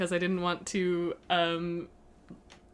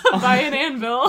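laughing; 5 semitones higher, 8 dB louder than usual. Real, not acted. Laughs while saying it.

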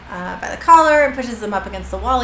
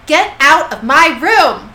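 loud, slow, high-pitched order; mock furious, each word punched; angry command; 5 semitones higher, 8 dB louder, slower than usual. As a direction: order them out with exaggerated force. Quoted villain ordering them out.

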